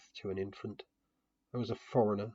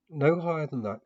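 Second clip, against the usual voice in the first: put-on raised, higher voice calling up to a climbing boy; 7 semitones higher, 9 dB louder than usual. A narrator's reading. Narrator calls up to Comte on the statue, louder and higher. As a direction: call it up the statue, firm but kind. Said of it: "I called".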